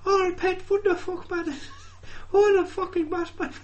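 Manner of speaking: very high, squeaky Geordie; absurdly high-pitched Geordie, breaking into laughter. Acted. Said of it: "then you got your shell calls".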